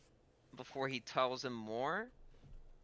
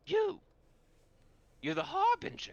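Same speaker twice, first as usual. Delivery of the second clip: high, loud creature shriek; loud, high-pitched revelation; excited hyena man; 7 dB louder than usual. Acted. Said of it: "the hyena man goes".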